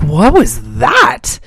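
slow stunned disbelief. Retold or imitated. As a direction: drag it out, baffled. Stunned reaction quoted.

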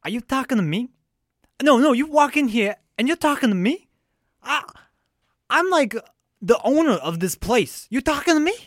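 high, pushy tough-guy voice; Robert De Niro impression. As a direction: confront him like a touchy mobster. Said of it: "Okay, I'll do Robert De Niro".

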